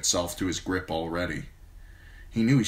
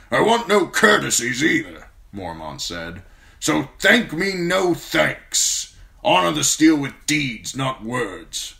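old commander voiced higher and louder, blunt and forceful; a gruff old commander praising a young man; gruff old lord commander; 8 semitones higher, 7 dB louder than usual. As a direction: speak as the stern old Lord Commander, grateful but brusque. Mormont voice again.

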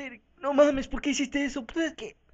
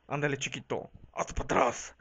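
much lower, half-whispered creepy voice; creepy brute; 12 semitones lower, 6 dB quieter than usual. Acted. Brute monkey luring the little one, low creepy menace. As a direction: menace him low and breathy like a lurking brute.

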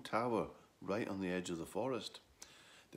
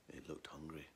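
whisper; 5 semitones lower, 13 dB quieter than usual. A narrator's reading. Narrator's hushed dragon moment.

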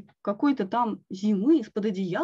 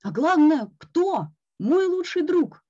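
mock indignation; 8 semitones higher, 4 dB louder than usual. A narrator's reading. Toad's mock outrage at friend.

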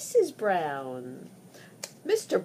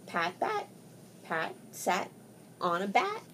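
ordinary delivery, nothing marked

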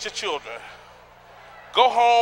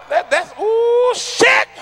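slow, high, loud gasp of disbelief; drawn-out mock astonishment; mock shock; 13 semitones higher, 5 dB louder, much slower than usual. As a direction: recoil at the outfit's colours. Reacting to outfit.

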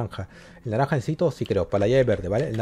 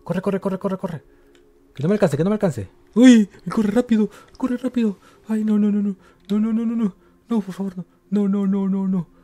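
being chased; 9 semitones higher, 4 dB louder than usual. Real, not acted. Fleeing the monster in game.

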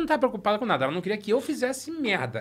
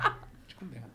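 much softer, lower hissed warning; mom's low hiss; 7 semitones lower, 10 dB quieter, slower than usual. Retold or imitated. Mom warning son among guests, much softer hissed threat. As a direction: murmur it under the breath, menacing. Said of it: "Sabe aquele "põe de conversa".

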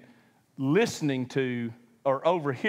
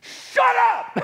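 high loud mock yell of Shut up; mock shout; 7 dB louder than usual. Preacher as an exasperated Jesus, a hammed-up loud yell. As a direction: snap it in hammed-up anger. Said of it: "that's not a kind word".

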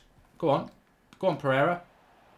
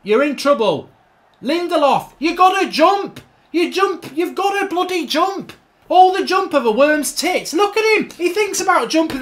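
raised, high-pitched rant at a player; exasperated shouting; 7 semitones higher, 6 dB louder than usual. Real, not acted. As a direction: berate a useless player loudly. Berating his player for not jumping.

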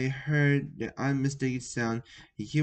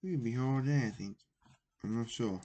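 ordinary delivery, nothing marked

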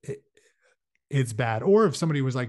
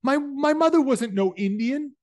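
much higher, louder protest; outraged shout; 13 semitones higher, 6 dB louder than usual. Acted. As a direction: defend his mother at full voice. Ernie defends his mother.

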